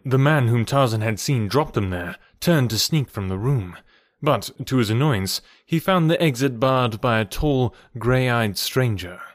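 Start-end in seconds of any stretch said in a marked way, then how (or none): none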